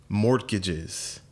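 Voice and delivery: ordinary talk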